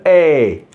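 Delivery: final É drawn out long; sound demo. Shown as a demonstration. Teacher shows what E accent aigu gives, long é. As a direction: stretch É as a clear "ay". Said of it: "ça nous donne le son de".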